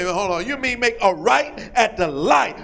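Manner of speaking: ordinary talk, nothing marked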